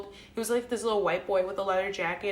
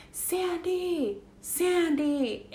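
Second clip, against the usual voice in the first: high, drawn-out emotional call of a name; mock plaintive cry, pitched high and falling; scared, almost crying; 8 semitones higher, much slower than usual. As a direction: imitate a frightened, tearful voice calling out. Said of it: "that person sounded, like, so scared and, like, crying almost".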